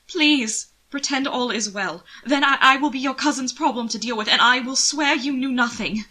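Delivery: loud, high, urgent begging; frantic pleading. Acted. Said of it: "to plead".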